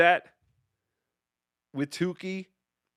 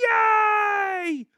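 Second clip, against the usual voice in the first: cheer. Real, not acted. Excited about getting a voice memo.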